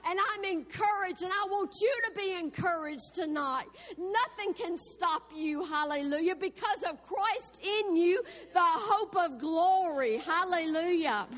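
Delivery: full-voiced revival preaching with hallelujahs. Real, not acted. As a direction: lift the congregation with fervent praise. Opening exhortation before the story.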